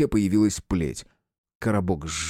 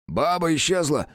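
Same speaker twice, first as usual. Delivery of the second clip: panicked report; 8 semitones higher than usual. Acted. Паника по рации.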